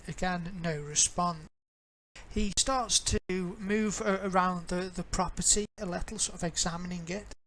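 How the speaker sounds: ordinary delivery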